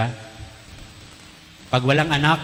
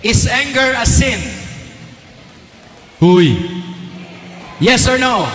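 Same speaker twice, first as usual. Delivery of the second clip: calling out; 8 semitones higher, 3 dB louder, slower than usual. Real, not acted. Priest calls on the congregation.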